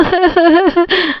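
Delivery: high staccato fake sobs; mock sobbing. Scolded wife crying over a saree, acted sobs. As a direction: sob in theatrical bursts. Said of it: "mummy कितना ज़्यादा रो रही है".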